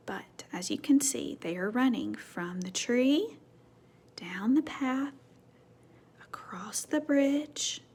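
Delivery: hushed storyteller; whisper. Real, not acted. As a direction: hush as you point at the page. Whispered picture reading.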